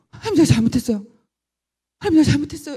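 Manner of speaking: loud, pleading confession to God; a wrenching, repeated plea for forgiveness; loud repentance. Retold or imitated. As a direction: cry out your apology to God. Repentant prayer.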